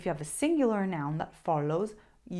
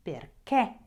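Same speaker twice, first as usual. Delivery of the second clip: "Perché" said for repeating, final é louder and punched; stress on last syllable; 5 dB louder than usual. Shown as a demonstration. Teacher asks learners to repeat after her, "Perché" stressed on the final é. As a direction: model "Perché" with a strong accented last syllable. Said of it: "Repeat it after me".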